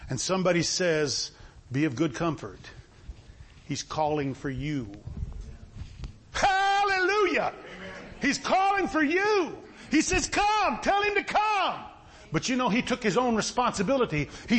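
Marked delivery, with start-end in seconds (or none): 1.7-4.9 low, hushed comforting voice; gentle reassurance. Acted. Crowd member gently comforts blind man. 6.3-7.5 high, drawn-out hallelujah cry; exultant praise. Real, not acted. Preacher exults at the calling. 8.2-11.8 high, stretched preacher's cry; exultant calling out. Real, not acted. Preacher exults, repeats the call.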